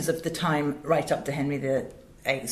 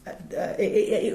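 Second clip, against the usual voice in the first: usual speaking voice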